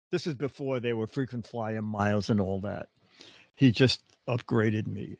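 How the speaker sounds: normal talking voice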